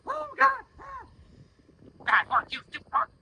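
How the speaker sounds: sudden high startled yelp, then cursing; panicked angry outburst. Shown as a demonstration. Rider losing it at a horse that threw its head. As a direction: jerk out a flustered curse. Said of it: "if she did that and I go".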